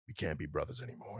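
low, quiet mock disowning; joking fake rejection. [name] pranking the brother who just came out, mock rejection. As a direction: drop it low and soft, deadpan. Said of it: "it was joking".

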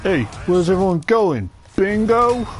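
high, excited villager voice; chirpy Spanish villager voice; villager impression. Imitating the game villager line.

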